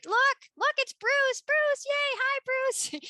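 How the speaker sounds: put-on high, bright little-kid cheering voices; excited kids. Kids cheering a friend in the hall, high excited voices. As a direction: squeal it like thrilled little kids.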